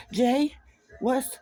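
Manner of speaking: slightly breathless. Acted. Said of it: "sounding slightly breathless".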